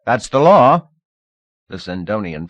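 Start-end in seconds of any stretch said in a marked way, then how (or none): 0.0-0.9 loud, higher shout from crowd. A narrator's reading. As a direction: call it out from the crowd. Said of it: "Someone shouted".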